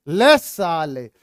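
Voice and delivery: slow, drawn-out schoolboy jeer; mock insult during a slate fight; mock fight taunt. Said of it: "गाली भी देवे ऐसी". (धीमा, खिंचा हुआ नकली ताना)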